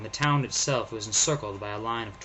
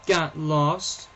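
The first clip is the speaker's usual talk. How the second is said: sniffling; 6 semitones higher, 5 dB louder than usual. Acted. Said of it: "he sniffed".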